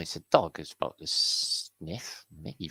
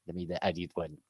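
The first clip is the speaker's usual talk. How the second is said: ordinary delivery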